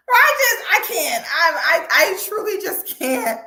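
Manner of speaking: high, loud laughing disbelief; can't get the words out for laughing; breathless giggling outrage. Real, not acted. Cracking up in disbelief.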